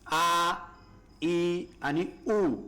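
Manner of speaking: आ, ई and उ stretched very slowly so they ring on; teacher showing how long vowels ring; drawn-out vowels like a temple bell. Shown as a demonstration. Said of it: "नेहमी मंदिरातली घंटी लक्षात ठेवायची".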